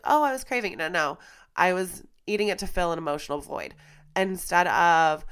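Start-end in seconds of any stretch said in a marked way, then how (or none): 0.0-0.8 mock excuse. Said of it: "it was like".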